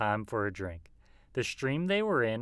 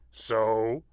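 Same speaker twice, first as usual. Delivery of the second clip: wolf voice; 4 dB louder than usual. A narrator's reading. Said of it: "woofed the wolf".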